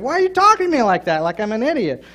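husband retelling how he snapped back at his wife; retold indignant protest. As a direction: push back, hurt and defensive. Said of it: "I said".

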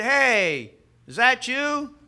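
loud, high acted call. Burglar hailing a parrot in the corner, calling out. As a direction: holler it across the room, drawn up high.

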